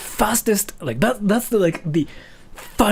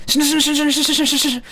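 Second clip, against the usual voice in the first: shinu rattled off fast and high; really fast; 10 semitones higher, much faster than usual. Shown as a demonstration. Showing viewers the fast part, shinu fast and high. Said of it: "Say this part really fast".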